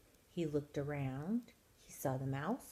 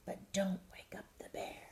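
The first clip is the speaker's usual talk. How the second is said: soft cautionary whisper; 6 dB quieter than usual. A narrator's reading. Hare warns mouse not to wake the bear, whispered. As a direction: whisper a careful warning. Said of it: "whispered the hare".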